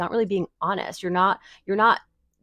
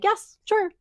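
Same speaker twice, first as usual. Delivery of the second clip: much higher, chirpy rapid agreement; reflexive fake yes; 13 semitones higher, 4 dB louder, slower than usual. A people pleaser saying yes to any request, chirpy forced yes. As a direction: rattle off a bright automatic yes. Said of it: "instead of just being like".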